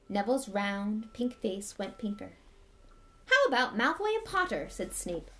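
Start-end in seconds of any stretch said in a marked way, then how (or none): 3.2-4.7 twisted smile. Acted. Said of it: "with a twisted smile".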